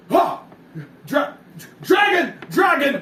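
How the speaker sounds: high, loud yelling of dragon; frightened high shout; panicked shout. Acted. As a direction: scream the alarm in terror. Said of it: "he hears that and goes".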